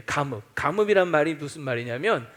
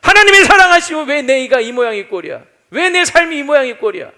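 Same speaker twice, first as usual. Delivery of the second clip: loud, high, bitter complaint; resentful outburst; 7 semitones higher, 12 dB louder than usual. Retold or imitated. Bitter believer complaining to God, loud and high. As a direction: hurl the grievance upward. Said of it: "속에서 올라오는 게 있는 거죠".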